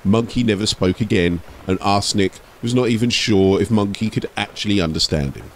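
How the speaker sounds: plain reading voice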